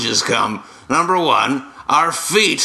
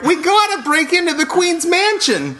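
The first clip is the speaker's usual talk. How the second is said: squeaky dwarf voice. Acted. Dwarves voiced in a comic bit.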